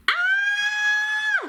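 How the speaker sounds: very high loud scream; shriek. A narrator's reading. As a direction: shriek in terror. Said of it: "shrieked Flap".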